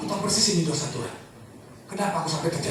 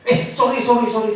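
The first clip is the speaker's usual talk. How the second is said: higher and louder, a quick startled sorry; startled apology; 7 semitones higher, 9 dB louder than usual. Retold or imitated. Wife to husband after spilling hot coffee, startled.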